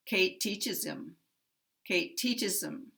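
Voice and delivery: teaches strong, him weak and unstressed; stress on teaches. Shown as a demonstration. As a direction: hit teaches, let him drop away. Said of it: "primary stress on teach".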